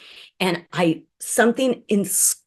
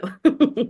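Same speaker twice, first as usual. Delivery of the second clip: short high chuckle; small self-deprecating laugh after admitting it; light, high giggle; 8 semitones higher than usual. Real, not acted. Laughs after her closing admission.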